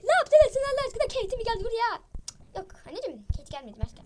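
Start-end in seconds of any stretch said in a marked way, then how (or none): 0.0-2.0 loud, high, outraged scolding; angry shouting. Acted. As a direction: shout at the kids in disbelief. Mother finds the messy room.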